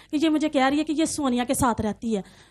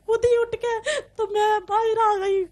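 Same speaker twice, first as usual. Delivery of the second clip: crying; 5 semitones higher than usual. Real, not acted. Recounting the beating, breaks down.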